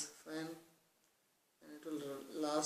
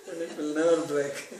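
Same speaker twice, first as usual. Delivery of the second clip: louder, words breaking up with laughter; laughing; 14 dB louder than usual. Real, not acted. Teacher finishing the fan joke for listeners, laughing loudly. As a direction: still laughing as you finish the line.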